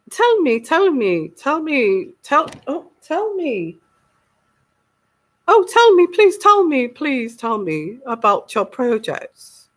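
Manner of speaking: hammed-up posh begging, each repeat falling away; posh Queen pleading. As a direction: plead in a put-on regal voice. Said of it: "she's got this posh voice".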